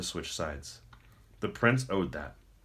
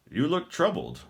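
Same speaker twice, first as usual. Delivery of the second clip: higher, knowing and smug; 9 semitones higher, 4 dB louder than usual. Acted. Maldor needles the troubled prince, a knowing smug tone. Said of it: "Maldor commented knowingly".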